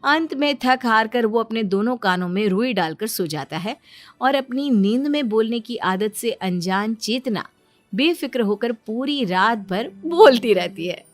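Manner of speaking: plain reading voice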